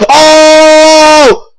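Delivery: sudden high cry of shock; shocked shout. Real, not acted. Teammate dies.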